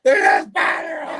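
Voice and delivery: mimicking the guide's spider scare, high and loud; mock shriek. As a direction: shriek it in fake panic.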